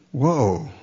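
hushed, awed whisper-like murmur; a quiet stunned prisoner wondering aloud. Retold or imitated. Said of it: "are you just gonna sit back and go".

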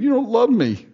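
high-pitched tearful complaint, acted out; mock crying. Samson's wife crying to him over the riddle, mock weepy. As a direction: put on a weepy, pleading wife. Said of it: "He, she cries".

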